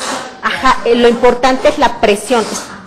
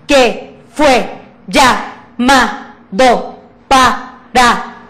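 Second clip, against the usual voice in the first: very slow, each syllable hit; syllabic reading; much slower than usual. Shown as a demonstration. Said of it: "Yo acentúo cada sílaba como si la golpeara".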